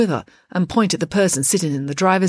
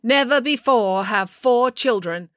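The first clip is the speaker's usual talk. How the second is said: slow, raised-pitch ceremonial speech; droning mayor; 6 semitones higher, slower than usual. A narrator's reading. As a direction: stretch it out like a long-winded mayor. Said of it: "droned Mayor Albright".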